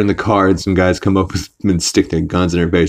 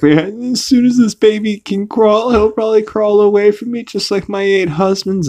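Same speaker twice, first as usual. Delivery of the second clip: high, put-on lament; mock Carol; 13 semitones higher than usual. Carol complaining about her husbands, high put-on voice. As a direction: whine it up high like a woman with bad luck in love. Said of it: "she's like".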